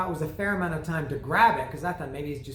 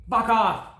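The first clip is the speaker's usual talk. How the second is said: higher and louder mock snap; mock fuck off; 6 semitones higher, 7 dB louder than usual. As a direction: shoo the buyer off in your head. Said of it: "In my head, I'm saying that".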